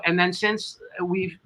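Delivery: ordinary talk, nothing marked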